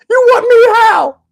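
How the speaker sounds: very high, stretched-out squeal of a question; incredulous excitement. Acted. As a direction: squeak it out in comic disbelief. Stunned reply to her plea.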